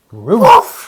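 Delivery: loud barking Roof; dog bark. Acted. Snapper the dog barks at the robots, loud. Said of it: "the dog barked".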